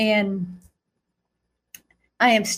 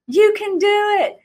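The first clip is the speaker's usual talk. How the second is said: put-on high, bright kids calling out encouragement; excited children cheering; 11 semitones higher than usual. Children cheering Jesus on from the stands, high and excited. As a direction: cheer him on like a crowd of kids. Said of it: "They're like".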